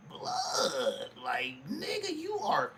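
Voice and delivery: acted exasperated reaction; exasperated disbelief. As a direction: react exasperated, pitch shooting up.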